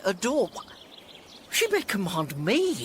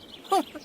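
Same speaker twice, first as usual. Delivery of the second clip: a high, gleeful little laugh; delighted giggle. Acted. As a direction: giggle with glee at the letter. Malvolio delighted by the letter.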